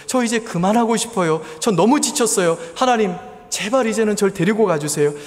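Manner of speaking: high, pleading cry to God, retold; wailing prayer. A woman at church crying out to God, begging. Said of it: "통곡하면서 울면서 하나님께 매달려 기도했다고".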